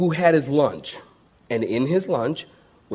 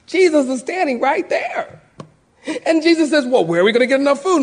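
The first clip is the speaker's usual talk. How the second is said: rising preaching fervor; 12 semitones higher, 4 dB louder than usual. Acted. Preacher to congregation, building fervor. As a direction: pitch climbing high as the preacher builds.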